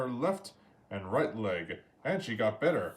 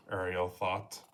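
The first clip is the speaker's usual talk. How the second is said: usual speaking voice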